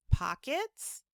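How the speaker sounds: high, soft puzzled question; a soft wondering echo; to himself. A narrator's reading. Corduroy overhearing the mother, softly puzzled. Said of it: "said Corduroy to himself".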